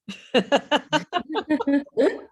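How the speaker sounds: high bubbling run of laughter; amused laugh, pitched well above speech. Real, not acted. Teacher left alone with one student, laughing.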